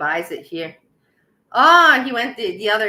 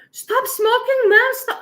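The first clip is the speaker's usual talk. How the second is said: much higher, louder imitated shout of a young clerk; imitating the store boy; 5 dB louder, slower than usual. Retelling the store kid who scolds him, a high loud imitated shout. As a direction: squeal it like the kid running out of the store. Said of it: "some little fat owl boy".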